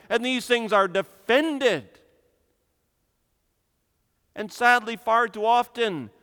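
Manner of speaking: loud, high, impassioned pulpit delivery; fiery preaching. Acted. Preacher condemning the age to his congregation, loud and impassioned. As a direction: thunder it from the pulpit against the evil.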